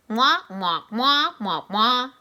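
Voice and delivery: muffled trombone-like mwah mwah, like talking underwater; garbled wah-wah of an unseen grown-up; Charlie Brown adult voice. Retold or imitated. Boy mimicking his teacher as muffled Charlie Brown adult noise. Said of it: "like the way grown-ups talk in Charlie Brown movies".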